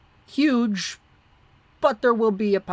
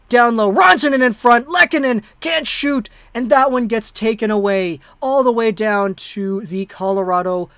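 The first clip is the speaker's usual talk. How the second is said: hockey call at a broadcaster's pace, rising with the action; amateur broadcaster calling a tight third period; live play-by-play; 6 dB louder than usual. Acted. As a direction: call the game like a TV announcer. Streamer calls the game live.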